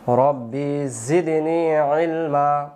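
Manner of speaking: slow, drawn-out رب زدني علما with زدني held long; slow Quranic recitation. Shown as a demonstration. Teacher models the dua for students in slow chant. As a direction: chant it slowly for students to repeat. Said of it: "বলেন".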